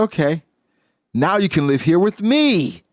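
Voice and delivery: hopeful mother. Retold or imitated. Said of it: "mother speaking".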